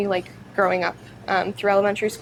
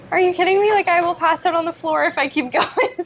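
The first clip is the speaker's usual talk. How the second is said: exasperated disbelief; 10 semitones higher, 4 dB louder than usual. Retold or imitated. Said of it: "And I was just like".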